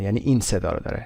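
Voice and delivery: ordinary delivery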